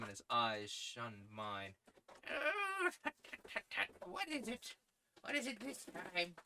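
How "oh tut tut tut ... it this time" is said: put-on high, tutting old man, a bit alarmed; fussy tutting editor. Old editor wary of a favour, high tutting voice.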